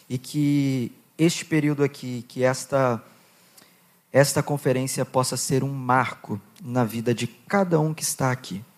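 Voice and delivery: low, earnest pastoral prayer; leading prayer. Acted. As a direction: pray over the young people, steady and heartfelt. Leads congregation in prayer.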